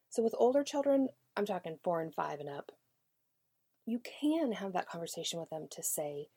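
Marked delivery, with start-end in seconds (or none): none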